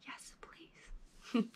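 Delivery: soft whispered longing; whispered yes please. Real, not acted. To viewers, dreaming of driving boats, whispered. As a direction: breathe it like a secret wish.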